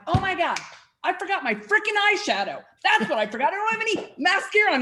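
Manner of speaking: high, laughing burst of alarm; sudden realization. Retold or imitated.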